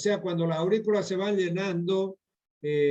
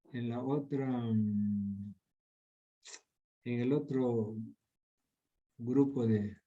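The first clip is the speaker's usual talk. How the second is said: low, soft talking to oneself; absent-minded searching; 6 semitones lower, 7 dB quieter than usual. Real, not acted. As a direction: trail along quietly while hunting for something. Murmurs while searching through slides. (murmurando distraído mientras busca)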